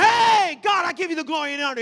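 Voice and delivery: loud, urgent preacher's shout; exhorting praise. Acted. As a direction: call the worshippers out loudly. Urging, acting out shouted praise.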